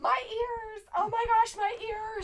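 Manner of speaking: very high, loud wail of mock pain; mock anguished cry. Host complaining about noise in the ears, mock wailing. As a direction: cry out as if deafened, hammed up.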